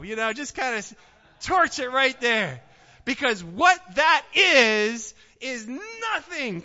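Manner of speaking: loud, high, fervent preaching; preaching at full force. Acted. Pastor urging the congregation to burn their worries, loud preaching. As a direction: drive it home with fire.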